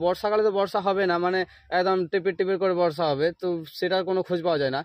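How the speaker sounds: normal talking voice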